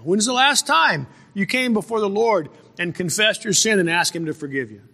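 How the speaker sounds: ordinary talk, nothing marked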